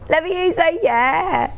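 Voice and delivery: a hint of an upbeat mom rallying the kids. Host quoting colleagues mocking her mum-like call. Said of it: "you've never sounded so much like a mom saying".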